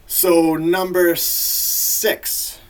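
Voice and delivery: very slow, loud, high countdown call; big announcement. Real, not acted. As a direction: draw it out like a hyped announcer. Announces next list entry, then repeats normally.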